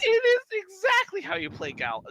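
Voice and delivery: high, loud squawking retort; high-pitched indignant goblin; defiant goblin king. Acted. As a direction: insist at the top of your range. GM argues back as goblin king.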